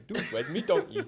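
mock Geordie through a laugh; Geordie accent. Player teasing the host for going Geordie, mock Geordie accent. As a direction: tease with a put-on Geordie "me". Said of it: "You went a bit Geordie".

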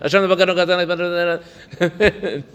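"Ashamnu, bagadnu" rattled off quickly, laughing; rushed. Shown as a demonstration. Teacher mocks a hurried confession, quick and laughing. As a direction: zip through it carelessly. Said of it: "Say it quickly".

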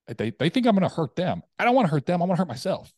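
high, loud acted-out realization; distressed protest. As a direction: protest urgently that they have misunderstood. Retold distressed realization about frightened family.

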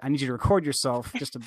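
normal talking voice

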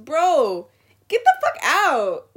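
loud outburst. Real, not acted. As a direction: blast it out. Ranting at Lunchable parents.

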